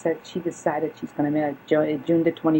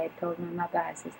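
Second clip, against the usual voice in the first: ordinary delivery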